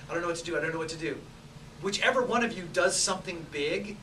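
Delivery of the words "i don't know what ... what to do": mock panic, rushed. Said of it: "the other person's like".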